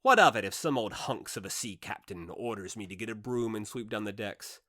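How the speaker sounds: plain reading voice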